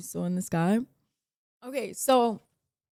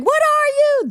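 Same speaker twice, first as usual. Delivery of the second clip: very high, much louder scream; screamed at the sky; 11 dB louder than usual. Retold or imitated. From the balcony to the sky, very high loud scream. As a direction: hurl the question at the sky. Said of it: "That's what I screamed".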